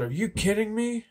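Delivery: high-pitched, incredulous retold question; imitated disbelief. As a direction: mimic a friend's stunned disbelief. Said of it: "almost started crying".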